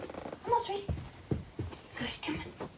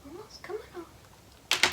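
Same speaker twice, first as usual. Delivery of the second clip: soft whispered coaxing; whispering; 3 semitones higher, 13 dB quieter than usual. Real, not acted. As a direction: whisper encouragement to the dog. Coaxing dog quietly.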